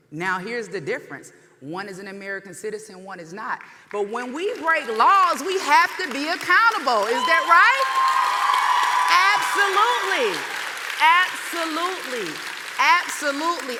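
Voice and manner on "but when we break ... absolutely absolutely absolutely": louder and higher, building to a cry; rousing shout. Real, not acted. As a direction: whip the crowd up. Rousing the crowd at rally.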